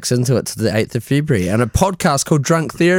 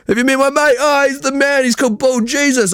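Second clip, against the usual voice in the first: put-on excited drunk mate; 12 semitones higher than usual. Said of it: "He, he kept jumping between".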